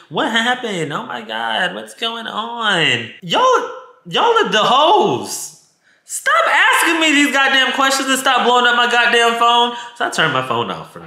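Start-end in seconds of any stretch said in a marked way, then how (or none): 0.1-3.1 high, drawn-out mock concern; mocking nosy friends. Woman mimicking his friends calling her phone, mocking. 3.2-9.7 high-pitched, indignant yell; angry outburst. Acted. Woman telling off the friends blowing up her phone, angry. Ranting at the nosy callers.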